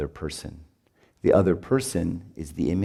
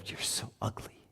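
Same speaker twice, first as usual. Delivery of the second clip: acted whisper, barely any voice; hushed mock disgust; whispered judgement; 2 semitones higher, 16 dB quieter than usual. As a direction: whisper the insult as if behind their back. Voicing a contemptuous judgement of others.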